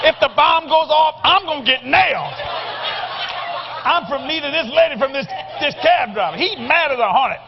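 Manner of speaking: loud, high-pitched mock alarm; comic panic. Passenger in the front seat fearing the bomb, comic panic. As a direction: protest like the one nearest the danger.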